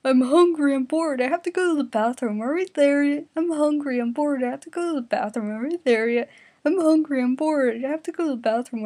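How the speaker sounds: loud, high nagging whine; whiny complaining. Acted. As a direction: whine it like a bored kid on a road trip. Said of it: "Kuwabara started to complain".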